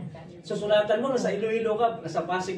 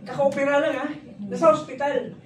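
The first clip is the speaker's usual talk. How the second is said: mother's voice; 6 semitones higher, 6 dB louder than usual. Retold or imitated. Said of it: "Sabi niya".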